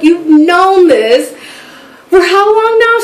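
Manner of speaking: high, drawn-out accusing question; retold indignant astonishment, pitch climbing; incredulous. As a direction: stretch it out in disbelief. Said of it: "I'm like".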